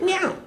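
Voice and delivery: high short rising meow; one high mock meow; demo cat meow. Shown as a demonstration. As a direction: reproduce the cat sound as best you can. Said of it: "best as I can produce it".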